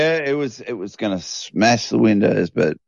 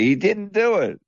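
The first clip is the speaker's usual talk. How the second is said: retold protest; 10 semitones higher than usual. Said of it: "and just say".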